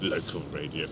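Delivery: muttered with mock envy; jealous. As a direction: say it like you're jealous. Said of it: "He said jealously".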